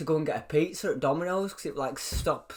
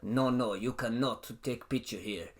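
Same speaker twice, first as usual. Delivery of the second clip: blunt Russian doorman refusing; imitated big Russian guy; Russian accent; 3 semitones lower, 4 dB quieter than usual. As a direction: forbid it in a mock Russian accent. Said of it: "how do you do a Russian accent".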